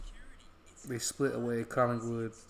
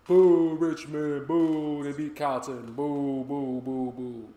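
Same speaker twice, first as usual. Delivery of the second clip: loud, slow mock booing; 3 semitones higher, 9 dB louder, much slower than usual. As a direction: boo the rival team like a rowdy fan. Booing a rival team.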